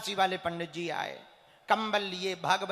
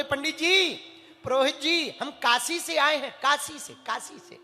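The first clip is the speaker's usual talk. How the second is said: proud boasting; 5 semitones higher, 5 dB louder than usual. Retold or imitated. Boastful visitor announces Kashi origin.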